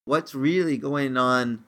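usual speaking voice

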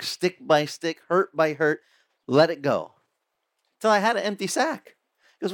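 ordinary delivery

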